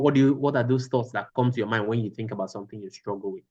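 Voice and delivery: usual speaking voice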